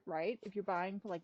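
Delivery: ordinary delivery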